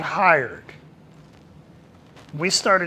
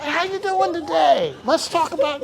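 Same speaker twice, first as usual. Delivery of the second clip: high, bubbly mock small talk; hammed-up friendly drop-in; chatty extrovert; 11 semitones higher than usual. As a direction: play the overeager chatter. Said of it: "go to your office and say".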